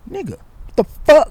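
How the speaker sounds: high, loud angry outburst; exasperated yell; snapping. Retold or imitated. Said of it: "I just snapped".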